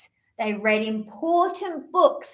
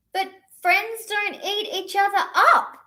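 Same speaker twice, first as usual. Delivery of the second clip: little bird; 8 semitones higher than usual. Acted. Bird pleads.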